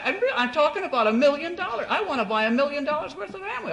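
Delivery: high, quick and laughing; laughing insistence. Retold or imitated. Caller pressing his million-dollar order, laughing and high.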